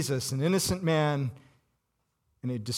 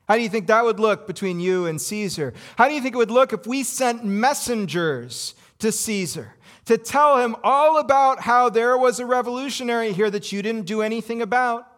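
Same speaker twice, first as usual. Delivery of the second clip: raised, pointed questioning; mock threatening; 6 semitones higher, 4 dB louder than usual. Leaders pressing Pilate, raised and threatening. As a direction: press him with a veiled threat.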